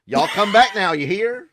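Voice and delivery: loud, high Southern holler; hammed-up country farewell in a Southern accent. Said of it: "fit in when I go back to Arkansas".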